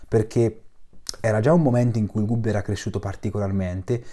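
ordinary talk, nothing marked

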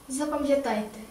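ordinary delivery

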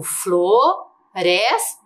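"floresta" slowly split as flo, res, ta; syllable by syllable. Shown as a demonstration. Teacher dictates syllables for the notebook, "floresta" split slowly. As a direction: dictate "floresta" in three separated, stretched syllables. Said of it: "você vai colocar as sílabas".

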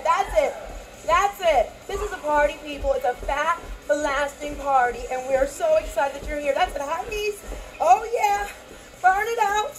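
ordinary talk, nothing marked

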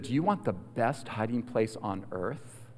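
soft, low, unbothered question; casual offer. Retold or imitated. Grandma offers panicking boy a hiding place, casual. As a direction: offer it quietly, as no emergency. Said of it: "very casually, like this wasn't".

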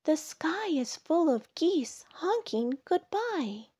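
high-pitched storybook bug; crying out. A narrator's reading. Ladybug cries news to sleepy Bear, high and calling. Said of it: "cried Ladybug".

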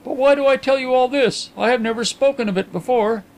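high-pitched, agitated gentleman's voice; Carlyle. A narrator's reading. Carlyle confiding in his blind friend, high and animated. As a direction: lift the voice well above the narration.

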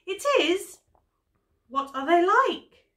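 high, loud, wide-eyed question; storybook bear's alarmed curiosity; astonished bear. A narrator's reading. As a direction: ask it loudly, voice swooping up. Bear alarmed, asks about beasts.

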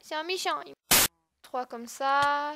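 ordinary delivery

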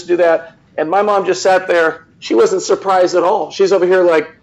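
ordinary talk, nothing marked

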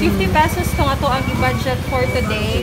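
muffled speech behind mask; talking with covered mouth; through a face mask. Real, not acted. Seen 2: Surgical mask covers his mouth and nose throughout; reference frames show it pulled to chin.

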